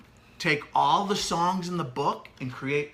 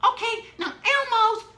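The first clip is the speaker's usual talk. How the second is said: silly Elmo voice; 6 dB louder than usual. Shown as a demonstration. Parent reading to William as Elmo, very high silly voice. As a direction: play Elmo for a toddler, way up high. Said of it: "the human jukebox".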